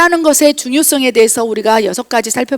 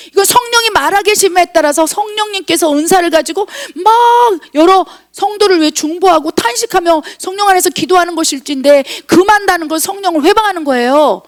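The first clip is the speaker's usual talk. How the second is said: urgent high-pitched sermon warning; forceful preaching; 5 semitones higher than usual. Real, not acted. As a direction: drive the warning home in a raised preacher's pitch.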